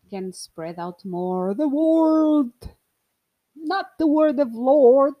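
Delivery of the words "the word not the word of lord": loud, drawn-out mock proclamation; mock preacher. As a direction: proclaim it slowly like a hammy preacher.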